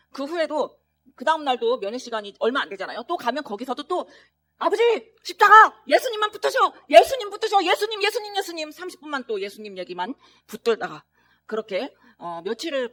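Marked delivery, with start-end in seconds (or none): 4.6-8.7 high, loud, pressing plea; loud urgent urging. Retold or imitated. Son urging his dying father in the ICU, loud urgent plea. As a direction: call into the father's ear, urgently.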